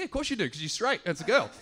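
normal talking voice

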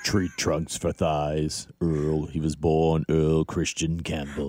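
low, slow, hushed legend-reel narration; slow, grave sports-film intro; mock dramatic announcer. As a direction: build him up like a documentary trailer. Dramatic intro of Earl Campbell.

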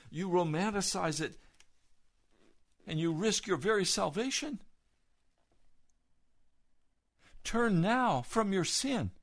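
loud, rising fiery sermon; preaching at full force. Acted. Preacher warning listeners to turn from sin, fiery and loud.

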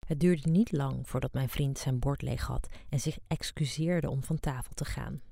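ordinary delivery, nothing marked

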